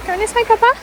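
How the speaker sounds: high, loud cooing to the dog; pet voice. Real, not acted. Owner asking her dog which way in pet voice.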